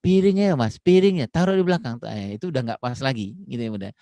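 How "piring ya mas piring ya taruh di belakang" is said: put-on high, loud nagging order to a child; bossy parent command. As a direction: bark out the chore like a nagging mum. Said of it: "masih dibilang". (ibu menyuruh anak dengan suara tinggi dan keras)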